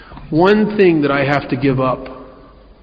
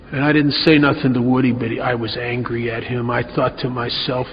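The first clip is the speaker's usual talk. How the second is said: ordinary talk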